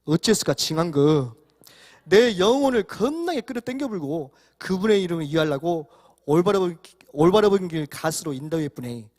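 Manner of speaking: comic psalm reading in broad Jeolla dialect. Shown as a demonstration. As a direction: start easy, grow livelier in Jeolla dialect. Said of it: "시편 이십삼 편 전라도 버전 한번 읽어드리겠습니다".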